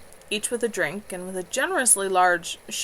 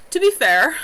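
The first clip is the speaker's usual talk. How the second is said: a louder, higher werewolf voice; wolf's voice; 8 semitones higher, 10 dB louder than usual. A narrator's reading. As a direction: speak up bluntly like a wolf. Wolf speaks up in dialogue.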